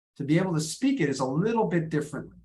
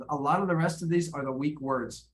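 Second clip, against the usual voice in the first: normal talking voice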